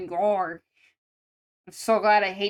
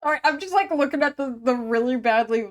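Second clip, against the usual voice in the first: excited; 4 semitones higher, 6 dB louder than usual. Real, not acted. Laughing at own bad drawing.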